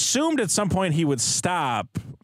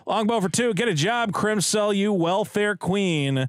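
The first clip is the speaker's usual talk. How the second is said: announcer voice; 4 semitones higher than usual. Acted. Reading super chat aloud.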